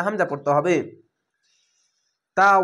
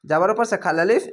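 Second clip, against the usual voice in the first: ordinary talk, nothing marked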